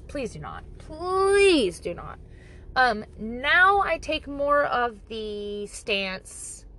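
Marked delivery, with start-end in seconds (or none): 0.1-0.6 quiet plea. Shown as a demonstration. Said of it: "if you think that I'm judging". 0.8-2.2 drawn-out plea. Shown as a demonstration. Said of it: "if you think that I'm judging".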